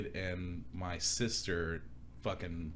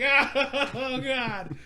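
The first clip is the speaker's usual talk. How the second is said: high, loud laughing oh God; laughing groan; 8 dB louder than usual. Retold or imitated. Brother opens the door, laughing high and loud. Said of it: "I was just like".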